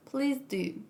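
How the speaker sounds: usual speaking voice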